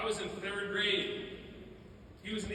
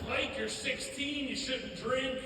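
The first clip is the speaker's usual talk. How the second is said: raised-pitch scolding, no real volume; mock parental yell; 6 semitones higher, slower than usual. Parents scold teenage son over drinking, mock yell. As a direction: lightly imitate parents telling off a son. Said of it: "My parents would yell".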